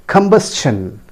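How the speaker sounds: combustion as kum-BUS-chun; correct pronunciation. Shown as a demonstration. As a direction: clear CH in combustion. (combustion का सही उच्चारण, कम-बस-चन)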